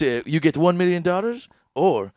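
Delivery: ordinary delivery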